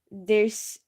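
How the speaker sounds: normal talking voice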